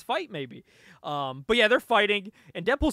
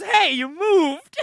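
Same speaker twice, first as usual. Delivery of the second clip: loud, high, drawn-out protest; mad complaint; 8 semitones higher, 10 dB louder, much slower than usual. Retold or imitated. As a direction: shout it in hammed-up outrage. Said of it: "Deadpool's mad that he moved".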